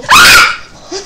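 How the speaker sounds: sudden high, very loud shriek; piercing yelp of surprise; real startled scream. Real, not acted. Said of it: "Qui c'est qui a gueulé comme ça".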